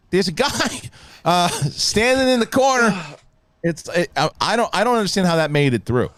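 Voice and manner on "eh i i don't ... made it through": ordinary delivery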